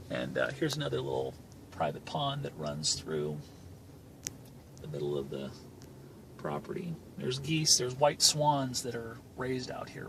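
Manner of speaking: hushed aside. Real, not acted. Narrating the drive to viewers, quietly murmured. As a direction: drop to a quiet murmur while pointing out the pond.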